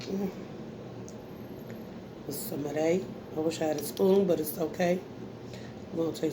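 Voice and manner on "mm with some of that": normal talking voice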